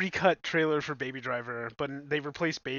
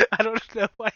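higher, real laughter through the words; laughing; 7 semitones higher, 6 dB louder than usual. Real, not acted. Co-host unable to explain own fit to podcaster, really laughing. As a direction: talk through helpless giggles. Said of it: "I'm laughing".

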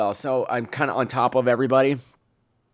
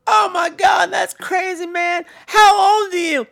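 shocked; 6 dB louder, slower than usual. Retold or imitated. Tin Man shocked.

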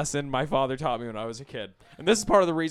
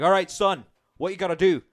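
put-on Northern Irish accent, higher and a bit louder; Northern Irish dad; 7 semitones higher, 4 dB louder than usual. Dad teaching son to dig potatoes, Northern Irish accent. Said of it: "I'll be like".

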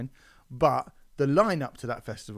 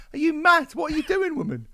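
mock outrage; 8 dB louder than usual. Host rants at the woman.

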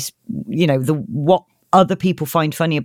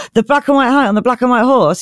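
higher-pitched mock amazement; hammed-up awed question; astonished pixie; 6 semitones higher, 3 dB louder than usual. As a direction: repeat it in starstruck disbelief.

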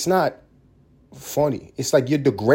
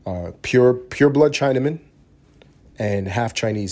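usual speaking voice

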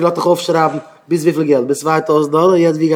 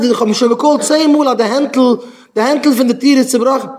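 high, loud tenant's grievance; acted exasperated outburst; agitated complaint; 9 semitones higher, 4 dB louder than usual. Acted complaint over a broken handle.

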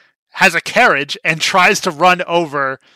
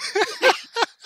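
real helpless giggle, high; high-pitched laughter. Real, not acted. Cracking up at co-host's story, high laughter. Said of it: "I'm laughing".

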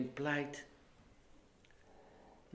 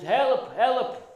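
schreeuwend hulpgeroep van een drenkeling; roepen om hulp; 11 semitones higher, 12 dB louder than usual. Retold or imitated. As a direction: roep het uit als iemand die verdrinkt. (luide noodkreet)

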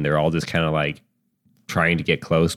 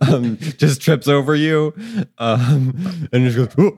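laughing; 7 semitones higher than usual. Real, not acted. Laughing narration.

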